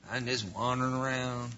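lightly voiced drifting soul; mildly spacey wanderer; aimless wanderer.

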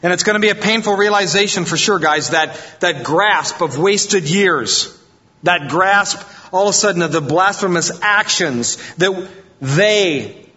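preacher stirring the congregation; preaching at full force. Acted. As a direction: push the words hard like a sermon peak. Preacher's surge about Israel's realization.

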